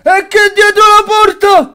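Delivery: slow, high dramatic shout. Acted. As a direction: boom it out like a trailer. Dramatic build-up at huge door.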